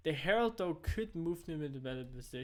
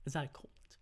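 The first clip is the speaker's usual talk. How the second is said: ordinary talk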